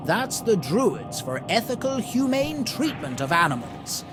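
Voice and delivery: ordinary delivery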